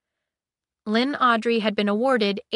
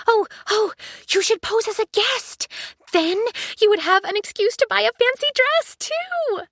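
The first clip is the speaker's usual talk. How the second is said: high, bright eager android voice; high excited AI chatter; chirpy ship AI; 14 semitones higher, 4 dB louder than usual. Acted. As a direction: pitch it up as an excited ship computer.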